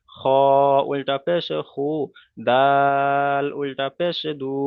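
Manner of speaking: slow, drawn-out letter-by-letter chant; slow recitation drill. Shown as a demonstration. Teacher modelling the letter line for students, slow chanted recitation. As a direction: recite each letter as a model, stretched slowly. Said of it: "তারপরে দেখেন উল্টা পেশ লাগিয়ে".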